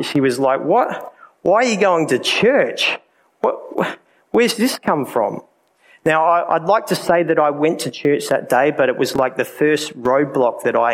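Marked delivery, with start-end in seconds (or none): 0.6-5.4 higher, mocking girlfriend's incredulity; scoffing disbelief. Girlfriend scoffing at his going to church, mock incredulous. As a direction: imitate a scoff at a silly idea, pitched up. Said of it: "she scoffed and laughed".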